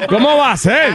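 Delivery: high-pitched comic protest; hammed-up granny scolding; mock scolding grandmother. Host acts out the scolding grandmother.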